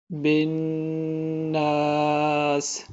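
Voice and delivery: الناس sung slowly, nasal نّ held long; ghunna demonstrated. Shown as a demonstration. Teacher demonstrating ghunna, slow melodic chant. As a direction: hum the نون of الناس through the nose. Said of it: "یہاں ہم غنہ کریں گے".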